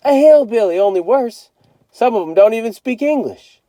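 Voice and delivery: high and loud, keeps spooking; scary-story older brother. A narrator's reading. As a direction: pile on the scare. Brother keeps scaring him.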